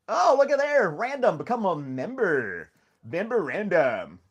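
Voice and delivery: loud, high burst, then slow drawn-out words; excited announcement. Real, not acted. Streamer hailing a viewer's new membership, excited and drawn out. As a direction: hail the new member, stretching out each word.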